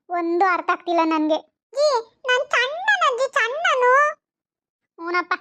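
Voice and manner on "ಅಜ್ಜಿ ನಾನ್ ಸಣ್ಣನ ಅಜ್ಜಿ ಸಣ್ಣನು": put-on high, whiny small-boy voice; little grandson. Grandson whining to grandma for chocolate, high and whiny. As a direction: plead like a spoiled little boy.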